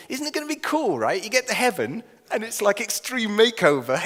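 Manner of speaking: high, loud, eager gush; thrilled at what is coming; imagined excitement. Acted.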